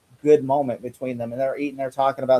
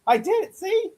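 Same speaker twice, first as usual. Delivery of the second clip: squeaky proud boast. Retold or imitated. Imitating proud Butch.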